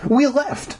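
high, sudden blurt. A narrator's reading. Girl lies to the janitor, blurting it out. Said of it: "I blurted out".

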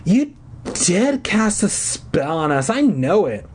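accusing. Acted. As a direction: press the charge in a strained voice. Hoarse sick girl accusing.

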